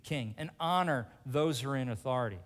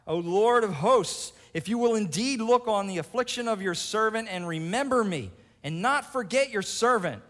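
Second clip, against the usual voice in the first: Hannah crying out; 8 semitones higher, 9 dB louder than usual. Acted. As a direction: cry out to God in anguish. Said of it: "wept bitterly and vowed a vow".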